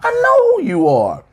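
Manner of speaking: very high mock woman voice; mock Rita recognizing him. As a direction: squeak it out in a put-on lady voice. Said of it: "Rita looks is like".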